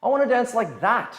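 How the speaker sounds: mock a frustrated student's longing; wishful dancer. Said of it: "they watch YouTube and go".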